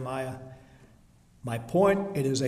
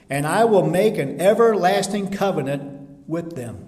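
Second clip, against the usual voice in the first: loud, ringing preaching of the verse; forceful scripture reading; 5 semitones higher, 10 dB louder than usual. Acted. Preacher booming God's promise to the church. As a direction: proclaim it to the congregation.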